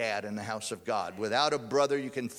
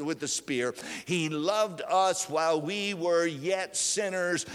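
full-force preaching; 5 semitones higher, 4 dB louder than usual. Acted. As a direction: preach it out to the whole room.

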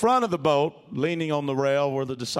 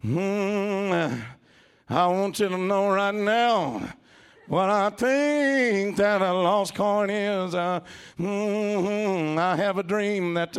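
higher lilting, slow sing-song; 6 semitones higher, slower than usual. Retold or imitated. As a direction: croon it in a sing-song preacher lilt. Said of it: "sing-songy voices".

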